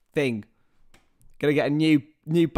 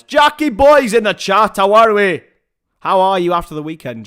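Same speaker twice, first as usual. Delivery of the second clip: loud, higher-pitched Scottish greeting; Scottish accent; 5 semitones higher, 12 dB louder than usual. Acted. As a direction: hail a mate in a put-on Scottish accent. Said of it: "That's a Scottish accent".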